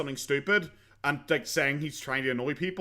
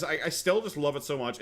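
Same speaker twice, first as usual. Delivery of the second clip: ordinary delivery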